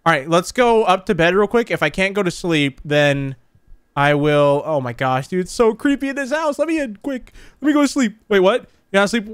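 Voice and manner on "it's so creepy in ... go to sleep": scared whine. Real, not acted. Scared in dark house.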